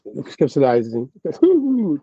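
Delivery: "conceptualizing" spelled out very slowly, syllable by syllable; slow careful. Shown as a demonstration. Reader to chat, slowly sounding out "conceptualizing". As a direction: work through "conceptualizing" like a tongue twister. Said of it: "tongue twister for me".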